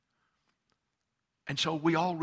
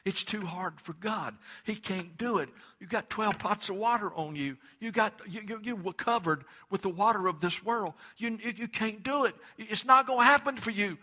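taunting enemy voice; 7 semitones higher than usual. Retold or imitated. The enemy telling the believer it's too hard for God, mocking and higher. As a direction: sneer the doubts at the listener like the devil. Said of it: "the enemy just comes and just says".

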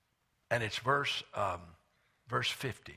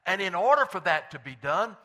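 loud, high-pitched pulpit preaching; full-voiced sermon delivery; 7 semitones higher, 7 dB louder than usual. Real, not acted. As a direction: preach it with raised voice, driving every phrase home.

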